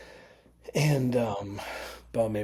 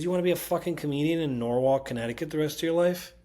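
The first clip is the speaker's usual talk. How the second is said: higher, louder retold scolding; exasperated challenge; 6 semitones higher, 5 dB louder than usual. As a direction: imitate her pushing someone to aim bigger. Retold scolding push to move on.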